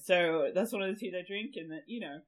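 usual speaking voice

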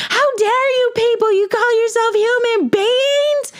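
high shrill imitated screech at the staff; furious mother imitated. Mother yelling at dental staff, imitated high screech. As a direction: play the outraged mom shrieking at reception. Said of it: "She's like".

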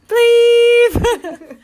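long high, loud imitated bleep; censor bleep. Covering a friend's name on the podcast, loud bleep. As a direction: squeal a censor tone, held and laughing after.

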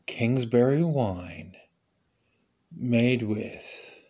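measured, spaced-out commercial read; slow ad tagline. Acted. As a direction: lay out each word of the slogan. Third tagline, words spaced.

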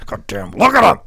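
half-shouted raving over a jacked guy; Vince impression. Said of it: "Vince would just be on headset just going".

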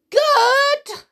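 piercingly high, loud screech; outraged scream. A narrator's reading. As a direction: scream the word in disbelief. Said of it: "she screamed".

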